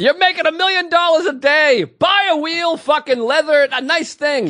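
high-pitched, exasperated rant; mock exasperation. Cohost ribbing friend over a duffel bag, mock exasperation.

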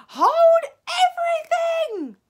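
long, piercing shrieked command. A narrator's reading. Witch halting the departing crowd, shrieking. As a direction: shriek it out across a ballroom. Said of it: "Her shrieking voice echoed through the ballroom".

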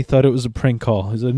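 ordinary talk, nothing marked

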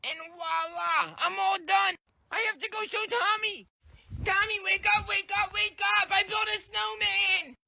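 put-on high kid voice, proud and pleading; eager little boy. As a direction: show off the tiny snowman.

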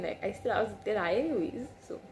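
ordinary talk

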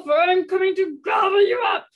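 loud, growling troll refusal; loud, menacing storybook troll. Acted. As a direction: boom it out like a mean troll. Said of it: "said the troll".

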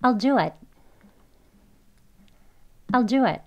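I'LL as a short "al" in "I'll do it"; brief reduced "I'll do it"; quick conversational form. Shown as a demonstration. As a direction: model "I'll do it" run together as "al-du-at". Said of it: "빨리 지나가는 발음으로".

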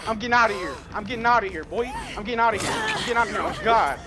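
panicked fleeing. Real, not acted. Fleeing in game.

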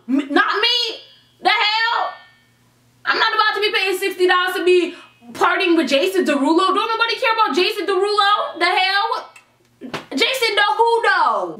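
high, mocking scorn; scornful disbelief. Real, not acted. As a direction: sneer at the name. Mocking the guest star.

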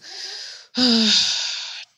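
loud, long, high heaving sigh; huge dramatic role-play sigh; heavy sigh. Pretend. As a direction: sigh hard, acting upset. Upset about sister in role play.

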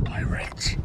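urgent hushed warning whisper. Pretend. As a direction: breathe it so nobody hears. Sneaky warning about pirates.

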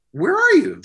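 loud, high, incredulous question; retold exasperated yell; alarmed demand. Retold phone demand to runaway singer.

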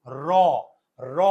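"র" said alone with an audible অ vowel; sounded-out syllable. Shown as a demonstration. Teacher shows students the vowel inside র, sounded out. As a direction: sound out "র" as a separate syllable, letting the অ ring. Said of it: "একটা অ এর উচ্চারণ হয়".

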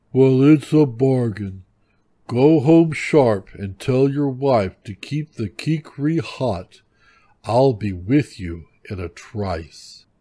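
loud, booming bear voice; big bear voice, slowing at the end; eager bear. A narrator's reading. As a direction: seal the deal loudly as a bear. Bear agrees eagerly to bargain.

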